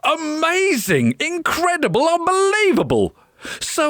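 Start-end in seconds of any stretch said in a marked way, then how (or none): none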